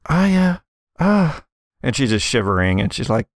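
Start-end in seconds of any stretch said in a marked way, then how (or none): 0.1-1.4 acted shaken stammer, higher pitch; high, halting half-words; almost incoherent. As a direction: falter as if too shivery to get words out. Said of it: "almost incoherent now that she's safe".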